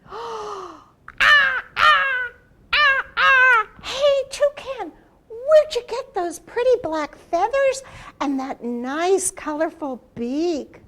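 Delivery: high, loud gasping call; excited Turtle. Acted. Turtle amazed by Toucan's feathers, high and loud. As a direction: gasp in delight and call up to Toucan.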